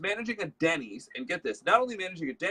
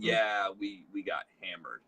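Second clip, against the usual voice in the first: weary manager; 6 semitones lower, slower than usual. Retold or imitated. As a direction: imitate a tired manager after a rough shift. Voices worn-out manager after rough night.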